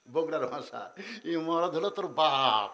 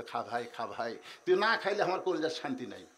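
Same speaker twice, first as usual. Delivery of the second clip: doting sister; 5 semitones lower, 5 dB quieter than usual. Acted. Sister coaxing brother softly.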